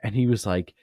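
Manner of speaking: ordinary talk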